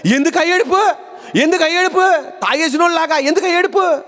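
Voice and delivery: high-pitched scolding prophet; Eli. Acted. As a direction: rebuke someone weeping, pitched well up. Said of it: "ఆ ఏలీ ప్రవక్త గారు అంటారు".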